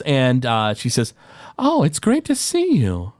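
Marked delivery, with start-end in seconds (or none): 1.6-3.1 higher, slow sweet gnome lady; gnome woman voice. Acted. Rock gnome woman greeting the player sweetly. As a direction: greet them with sugary delight.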